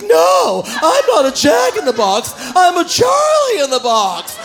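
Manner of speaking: loud, high, drawn-out toy voice; Charlie in the Box. Acted. Comic plays misfit toy correcting visitors, high loud shout. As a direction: protest as a hammed-up cartoon toy. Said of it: "see that Jack in the Box".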